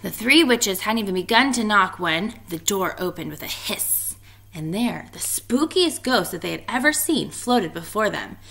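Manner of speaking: usual speaking voice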